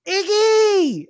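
high-pitched gleeful greeting; delighted kid. Acted. Kid greeting his little brother, high delighted call. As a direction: call out high, thrilled to see him. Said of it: "he looks and he goes".

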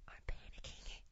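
unvoiced panicked whisper. At the bar, telling Heidi secretly, panicked whisper. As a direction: hiss it secretly to your wife.

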